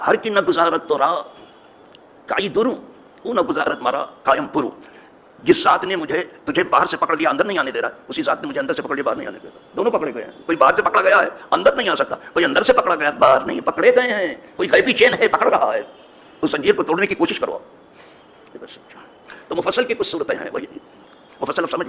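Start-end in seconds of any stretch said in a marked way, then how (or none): 0.1-4.7 slow, louder Persian verse recitation; reciting a poem. Acted. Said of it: "اب ان کے ایک شعر کو کم از کم سنا ہی دوں میں". 5.4-17.6 louder, building sermon, hammering each phrase; preacher explaining the verse with full force; forceful preaching. Acted.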